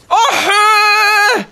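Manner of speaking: high, loud, drawn-out yelp; real startled yelp; pained yell. Real, not acted. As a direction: cry out as if hit. Yelling after taking a hit.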